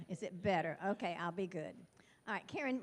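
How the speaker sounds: ordinary talk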